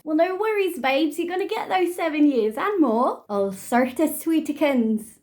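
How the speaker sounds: high, loud sarcastic cheer; sing-song mocking comfort; mock-sweet reassurance. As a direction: gush with fake sympathy. Mocking reply to the killer poet.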